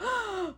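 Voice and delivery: quick theatrical gasp; hammed-up gasp of wonder; mock awestruck gasp. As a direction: gasp as if dazzled. Mock awe at beach entrance.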